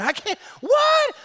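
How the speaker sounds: high, loud, slow outraged yell; retold scream. Pastor yelling at a football ref, outraged shout. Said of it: "I started screaming".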